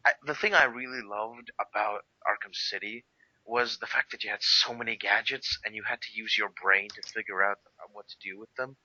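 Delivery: ordinary talk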